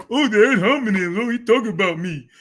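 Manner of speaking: put-on crybaby complaint; mocking imitation. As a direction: mimic someone moaning about being picked on. Mimics an opponent complaining of insults.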